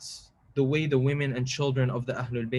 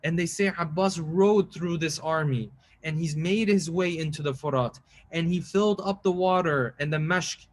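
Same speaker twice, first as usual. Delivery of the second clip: raised, grieving preacher's voice building to a plea; lamenting sermon; 4 semitones higher than usual. Acted. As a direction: preach the mourning story with rising grief. Tells Karbala with rising lament.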